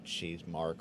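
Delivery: ordinary talk, nothing marked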